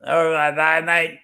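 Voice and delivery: high Aussie imitation; mock Australian accent. Joining in the friend's joke, Australian accent. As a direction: echo it as an Australian mate. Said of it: "That's my best Australian accent".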